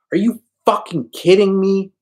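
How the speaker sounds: high, loud enraged yell; Tony rages at her doubt, furious shout. Acted. Explosive disbelieving rage.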